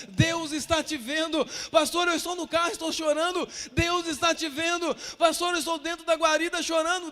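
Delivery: loud, driving refrain from the pulpit; insistent preaching. Real, not acted. Pastor answering weeping believers that God sees them, forceful preaching. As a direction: build it up with a hammered refrain.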